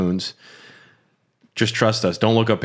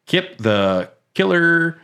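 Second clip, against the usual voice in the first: dramatic drawl; 7 semitones higher, much slower than usual. Pretend. Hams up supporter's nickname.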